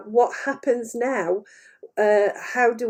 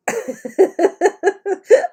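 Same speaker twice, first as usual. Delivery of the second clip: real burst of laughter; helpless amused chuckle; 8 semitones higher, 5 dB louder than usual. Real, not acted. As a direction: laugh out loud mid-explanation. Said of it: "they're laughing".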